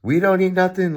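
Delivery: high, loud grandma voice; grandmother imitation. Grandson quoting his grandmother on diet, high mock grandma voice. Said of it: "she goes".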